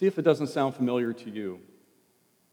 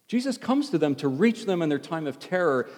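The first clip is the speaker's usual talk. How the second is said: usual speaking voice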